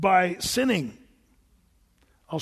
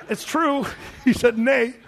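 real laugh through a louder nay; 5 semitones higher, 5 dB louder, slower than usual. Real, not acted. Preacher laughing while repeating Balaam's answer. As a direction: chuckle as you repeat it. Laughing at his own joke.

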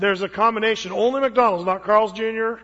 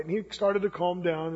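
ordinary talk

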